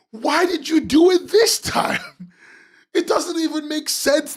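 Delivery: high exasperated yell at the artist; exasperated shout. Real, not acted. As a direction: throw the question at the screen. Yelling at the artist.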